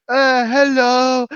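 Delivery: slow, high, goofy drawn-out hello; imitating friend. Big teammate greeting a stranger's baby, slow goofy hello. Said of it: "and he's like".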